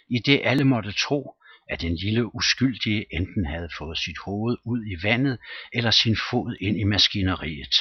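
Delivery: ordinary delivery, nothing marked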